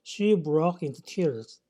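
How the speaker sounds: very slow, careful English sentence; slow example reading. Shown as a demonstration. English teacher reading an example sentence slowly to students. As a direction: read each English word slowly for learners.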